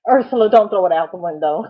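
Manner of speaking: half-laughing, pleading with her not to give up; laughing plea. Real, not acted.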